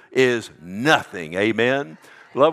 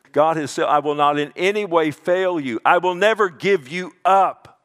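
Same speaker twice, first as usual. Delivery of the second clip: rising, louder declaration; forceful proclamation; 5 semitones higher, 5 dB louder than usual. Real, not acted. Pastor declaring God's promise to congregation, forceful.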